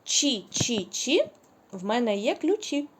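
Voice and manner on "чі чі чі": each syllable spaced out slowly; slow, deliberate syllables; slow syllable drill. Shown as a demonstration. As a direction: spell the rhyme out slowly for children. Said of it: "я читаю рядочок".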